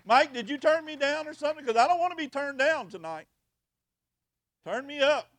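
loud, high call to the sound booth. Real, not acted. Preacher calling to the sound man Mike, loud and high. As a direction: project it to the back of the room.

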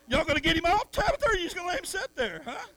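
high, loud, fast prodding; loud urging. Real, not acted. Pastor calling helpers in a church demonstration, high and loud. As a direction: push them to act now.

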